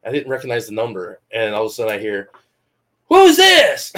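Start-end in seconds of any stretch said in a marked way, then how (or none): 3.1-3.9 high shouted phone greeting, imitated; loud caller. Unknown caller startling the podcaster, imitated as a high shout. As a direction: bellow it suddenly, mimicking the caller. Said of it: "He's very loud".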